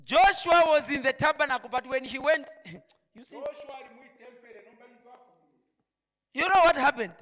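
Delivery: loud, high-pitched sermon delivery; full-force preaching. Acted. Preacher telling the congregation why Joshua didn't worry, full-force preaching.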